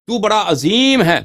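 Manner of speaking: slow, loud, exalted praise of God; emphatic glorifying shout. Shown as a demonstration. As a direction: proclaim تو بڑا عظیم ہے with rising awe, stretching Azeem. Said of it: "تکرار کرتے ہیں تفخیم کے لیے".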